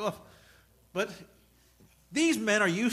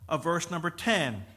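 ordinary talk

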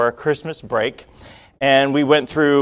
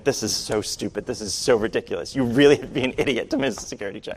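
mad muttering; 3 semitones lower, 6 dB quieter, faster than usual. Retold or imitated. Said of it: "I'm really mad now".

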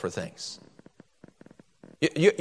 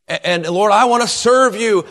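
loud, raised, hammed-up pledge; mock fervent vow; 5 semitones higher, 5 dB louder than usual. Worshipper promising the Lord service, mock fervent vow. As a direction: imitate an over-earnest promise to God. Mimicking an earnest vow at altar.

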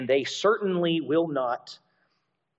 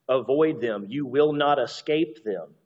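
ordinary delivery

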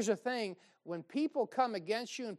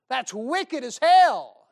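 slow, much louder judgment; loud condemnation; 6 semitones higher, 15 dB louder, much slower than usual. Acted. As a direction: hammer it out slowly. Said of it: "preacher condemns loudly".

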